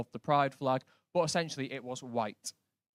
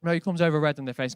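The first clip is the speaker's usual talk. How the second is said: normal talking voice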